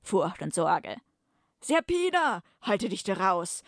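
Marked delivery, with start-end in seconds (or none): 1.6-3.6 high, dramatic cry of her name; urgent call. Acted. Captive calling to Serpina to stay out, high urgent call.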